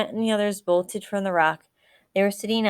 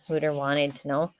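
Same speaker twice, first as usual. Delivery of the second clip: ordinary delivery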